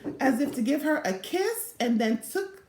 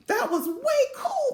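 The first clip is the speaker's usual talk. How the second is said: much higher, delighted exclamation; excited; 12 semitones higher than usual. A narrator's reading. Principal praises the butterfly release to Velma, excited. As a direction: gush with delight. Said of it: "the principal said".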